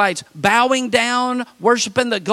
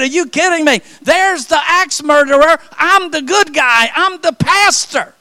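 high, loud, exasperated outburst; incredulous protest; 8 semitones higher, 7 dB louder than usual. Retold or imitated. Pastor retells protesting to the police, loud and high. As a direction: throw up your hands, loud and high.

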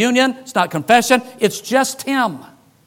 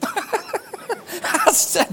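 laughing; 9 semitones higher, 4 dB quieter than usual. Real, not acted. Laughing at the punchline.

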